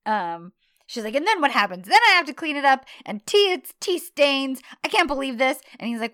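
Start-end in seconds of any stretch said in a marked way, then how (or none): 1.2-5.6 loud, raised-pitch acted rant; hammed-up exasperated complaint; mock yelling. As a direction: rant it as a staged scolding. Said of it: "that's when she starts yelling at him".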